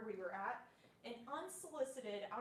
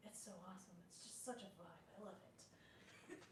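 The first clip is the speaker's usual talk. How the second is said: dreamy hush; 4 semitones lower, 14 dB quieter than usual. Retold or imitated. Dreamy drunk admiration of store.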